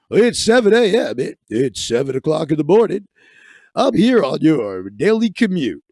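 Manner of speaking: cheesy DJ on the daily commute; radio announcer. Pretend. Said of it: "read it like a radio announcer".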